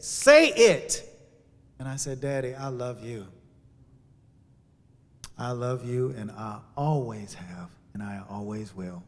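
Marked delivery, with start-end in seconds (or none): 0.0-1.0 insistent, louder and higher; urgent command. Retold or imitated. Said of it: "I heard the voice". 2.2-9.0 fading, softer tender confession; barely holding back tears; tender love. Retold or imitated. Telling father he loves him.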